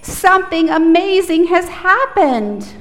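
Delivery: loud, high call-out; loud demo line; really loud. Shown as a demonstration. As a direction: belt it out for the kids to echo. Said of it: "repeat after me really loudly".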